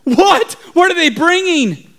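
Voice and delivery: high, urgent demand; alarmed question. Acted. Townspeople question the lookout, alarmed. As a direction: demand the news in a panic.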